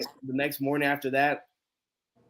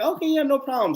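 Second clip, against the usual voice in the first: agreeable stranger; 10 semitones higher than usual. Retold or imitated. Retold stranger agrees to help.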